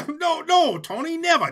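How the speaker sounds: high, loud, laughing backpedal; nervous laughing denial. Acted. Henchman backpedaling to his angry boss, nervous laugh.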